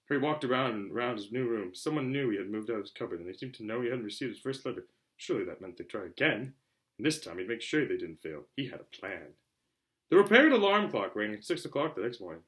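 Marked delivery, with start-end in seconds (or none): none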